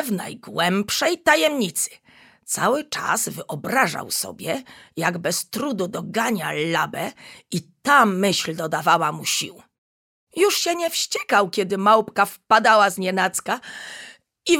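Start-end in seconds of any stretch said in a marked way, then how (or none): none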